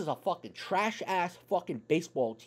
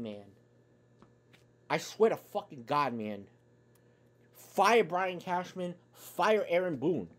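usual speaking voice

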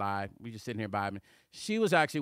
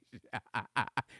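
real amused chuckle; chuckle, tickled by a comment; amused laugh; 3 dB quieter than usual. Real, not acted. Host tickled by a viewer's comment, amused laugh.

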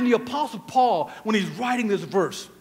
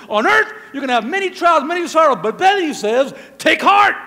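loud, rising preacher's delivery; full-force preaching; 6 semitones higher, 8 dB louder than usual. Acted. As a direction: build up to a triumphant shouted command.

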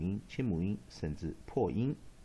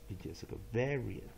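usual speaking voice

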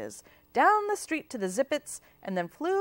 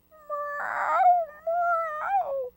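very high thin meows; tiny kitten meow; 4 dB louder than usual. A narrator's reading. Kitten stuck up a tree calling Watilda, tiny high meows. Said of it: "heard a tiny sound".

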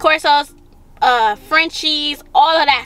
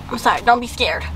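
ordinary talk

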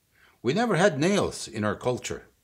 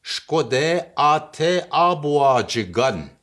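Shkode ate abwa jigan said very slowly, word by word; slow teaching demo; 3 semitones higher, much slower than usual. Shown as a demonstration. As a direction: lay out each part of Shkode ate abwa jigan for learners. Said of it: "The fire extinguisher".

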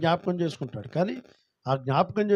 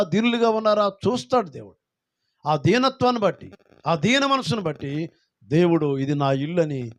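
raised, higher sermon voice; full-force preaching; 7 semitones higher than usual. Acted. Preacher exhorting congregation, fervent preaching. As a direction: preach it out to the hall.

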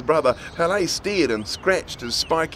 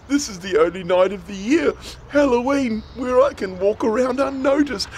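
high, wistful witch voice; a lonely witch explaining, near tears; sad witch; 10 semitones higher than usual. A narrator's reading. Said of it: "about to cry".

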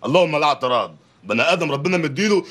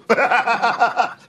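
laugh; 5 semitones higher than usual. Acted. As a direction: burst of laughter. Laughs after mocking the painting. (ضحكة)

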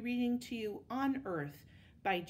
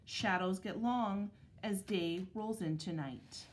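measured picture-book reading with clear pauses; unhurried, carefully articulated storybook narration; read-aloud to children; slower than usual. Acted. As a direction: read it to second graders, phrase by phrase. Reads picture book to second graders.

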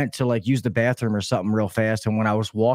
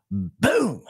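high, sudden vocal boom; imitated door bang; 8 semitones higher than usual. Technician tells of wife kicking in the door, high imitated boom. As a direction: act out a door being kicked in.

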